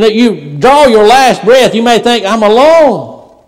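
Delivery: loud, high, ringing preacher's voice; a revival preacher lifting the room with every line; full-force preaching. Acted. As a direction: preach it out hard to the pews. Preaching to congregation.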